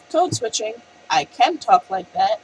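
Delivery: ordinary talk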